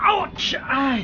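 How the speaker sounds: high cries of pain; pained cry. Acted. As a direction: cry out as you land. Hurt after falling.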